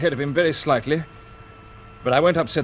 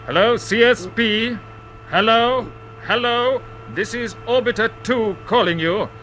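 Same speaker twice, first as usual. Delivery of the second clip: higher, slow radio call trying to get through; 6 semitones higher than usual. Acted. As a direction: hail the base clearly, a little raised. Pilot calling control by radio.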